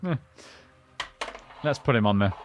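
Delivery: ordinary talk, nothing marked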